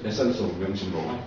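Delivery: ordinary talk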